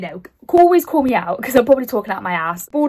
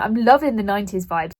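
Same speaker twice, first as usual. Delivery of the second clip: normal talking voice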